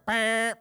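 high, sustained honk sound; imitated car horn. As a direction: mimic a blaring horn.

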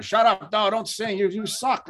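a light imitation of an old actor yelling at a film; retold yelling at the screen. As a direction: heckle the movie like a cranky old tough guy. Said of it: "he's yelling at the characters on the screen".

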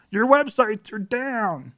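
high, slow robotic alert voice; alert email. Retold or imitated. Reading the outage email at breakfast, high and slow. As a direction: read it like a cold automated warning.

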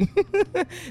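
risada aguda. Real, not acted. Host laughs at the dropped call.

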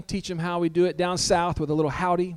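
ordinary delivery

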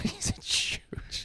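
laughing whisper. Real, not acted. Echoing friend's insult with laughter.

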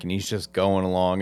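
ordinary delivery

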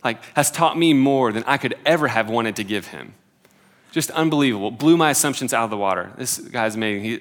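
normal talking voice

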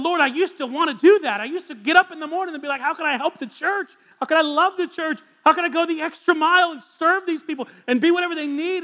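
fiery sermon delivery; full-force preaching. Acted. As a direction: preach it out over the room.

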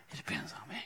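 soft whispered point. Real, not acted. Pastor confessing to congregation, whispered. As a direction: whisper it for weight. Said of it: "whispered emphasis".

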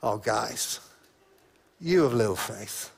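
soft, low, tender rebuke; gentle Jesus. Shown as a demonstration. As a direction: say it kindly, like a sigh to friends. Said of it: "I wonder whether it was much more gentle".

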